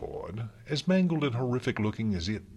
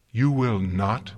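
louder, drawn-out refusal left hanging up; slow firm challenge; 4 semitones higher, 6 dB louder than usual. Acted. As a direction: forbid him slowly, ending on a rise. Celestia forbids, first take.

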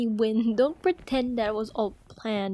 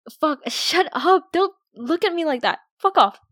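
loud, higher, embarrassed swearing; flustered shout; 6 semitones higher, 7 dB louder than usual. Acted. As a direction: snap back loudly, caught off guard. Flustered outburst at teasing friend.

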